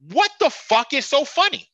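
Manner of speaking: loud, high indignant yell; angry shout. Real, not acted. Fan ranting at players laughing on the bench, angry shout.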